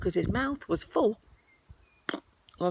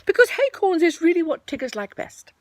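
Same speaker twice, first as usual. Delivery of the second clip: higher, louder eager Tigger; 12 semitones higher, 7 dB louder than usual. Acted. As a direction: promise it like a hungry tiger.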